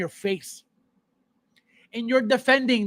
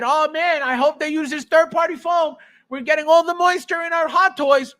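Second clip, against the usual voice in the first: higher, louder mocking imitation of an eager fan; 6 semitones higher, 4 dB louder than usual. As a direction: ham up a gushing collector. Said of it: "when have we ever said".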